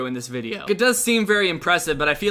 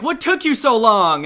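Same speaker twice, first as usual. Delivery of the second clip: loud, slow, higher scolding; mad mom; 5 semitones higher, 6 dB louder than usual. Pretend. Angry wife scolding her late husband. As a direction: snap at him for being late. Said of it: "the mother's like".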